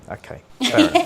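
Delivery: very high mock-child voice; unimpressed kid. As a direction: squeak out polite indifference. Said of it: "looking at me like".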